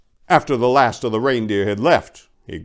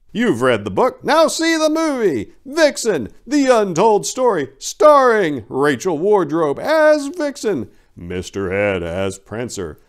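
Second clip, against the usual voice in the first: higher, louder hammed-up movie promo; trailer announcer; 11 semitones higher, 5 dB louder than usual. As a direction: sell the film like a trailer voice. Mock movie trailer read.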